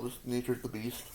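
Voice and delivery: normal talking voice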